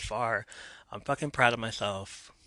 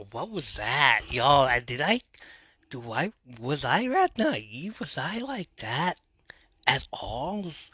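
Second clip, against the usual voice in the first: ordinary delivery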